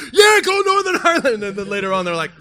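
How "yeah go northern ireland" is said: high, loud imitation of cheering; mock fan cheer. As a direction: cheer like a clueless young fan. Acting out naive kids cheering.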